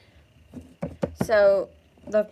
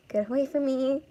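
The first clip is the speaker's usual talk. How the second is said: higher-pitched, acted fearful plea; frightened plea; 5 semitones higher than usual. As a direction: plead with him to back off. Girl cornered by drunk stranger.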